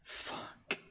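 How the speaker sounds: breathless whispered swear under the breath; whispered curse. Real, not acted. Streamer cursing the broken search, whispered. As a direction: curse quietly at the screen.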